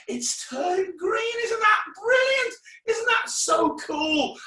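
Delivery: high-pitched showman's amazement; overjoyed, way up high; hammed-up delight. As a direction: squeal with put-on wonder, drawn out. Feigning delight at the trick.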